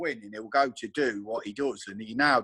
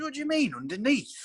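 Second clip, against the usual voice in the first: puzzled; 12 semitones higher than usual. Retold or imitated. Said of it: "He goes".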